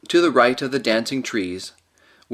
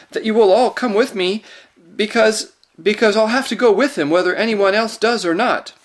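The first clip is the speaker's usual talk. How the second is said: higher, a little louder, trembling plea; tremulous; 9 semitones higher, 5 dB louder than usual. A narrator's reading. Lucy begging the others to follow, tremulous and higher. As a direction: hold back tears as you insist. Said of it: "in a tremulous voice".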